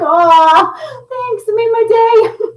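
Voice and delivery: high, loud gushing thanks; high mock-tearful gushing thanks. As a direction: squeal it like you're touched. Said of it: "I was like".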